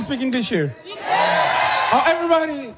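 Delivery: high-pitched crowd greeting; calling to crowd. Acted. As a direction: call out long and high to the room. Performer greets the crowd on stage.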